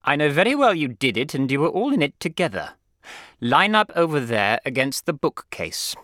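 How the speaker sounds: loud, commanding schoolmaster; stern headmaster. A narrator's reading. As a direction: bear down on the boys with authority. Headmaster accusing and ordering boys.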